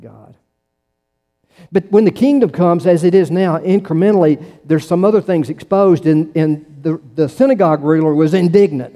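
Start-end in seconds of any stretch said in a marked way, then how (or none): 1.7-8.9 preaching with force. Acted. Preaching climax.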